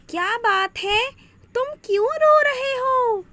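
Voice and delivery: high, squeaky little fish voice; a tiny fish asking kindly. Acted. Talking fish voiced by narrator.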